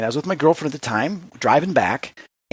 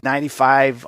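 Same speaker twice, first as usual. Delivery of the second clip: ordinary talk, nothing marked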